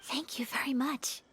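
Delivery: whispered thanks, barely voiced. Kamisato whispers thanks back to the tailor. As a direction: answer the hush in kind. Whispered reply to secret handover.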